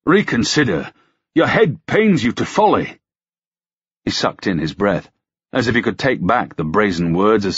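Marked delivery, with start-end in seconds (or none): 0.1-3.0 urgent, higher-pitched protest; alarmed, impulsive appeal; bursting out. A narrator's reading. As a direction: plead heatedly with a superior. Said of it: "he burst out".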